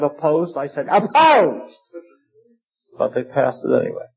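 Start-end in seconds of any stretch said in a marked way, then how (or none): 0.9-1.8 loud, high-pitched shout of a single word; re-enacted loud, defiant no vote. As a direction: belt out the vote so the whole room hears. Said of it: "And I said it loudly".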